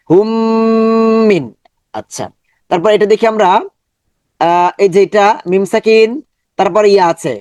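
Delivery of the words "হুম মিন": long hum joining "হুম" to "মিন"; held ghunnah. Shown as a demonstration. Teacher joins the syllables, held ghunnah. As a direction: hold the m of "হুম" long, then land on "মিন". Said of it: "মিমটাকে ধরবে এবং দূরে রাখবে".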